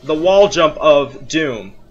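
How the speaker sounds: low, slow weary announcement; name the dreaded obstacle low and slowly; subdued tone of doom; mock-ominous. Real, not acted.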